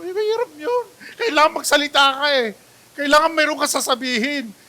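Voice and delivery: mock whine. To the congregation, a mock whine about holding in anger. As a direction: pitch jumps up into a whining cry.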